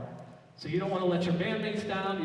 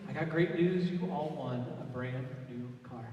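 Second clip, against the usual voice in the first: normal talking voice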